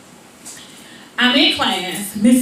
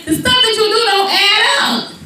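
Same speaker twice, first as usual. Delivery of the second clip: high, insistent scolding; acted exasperated father; dad's lecture; 8 semitones higher than usual. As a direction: press the point in a raised pitch. Dad lectures child in story.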